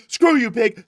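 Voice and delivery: high, loud mock defiance; hammed-up insult; defiant suspect yell. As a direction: sneer at the cop. Acting out arrested man.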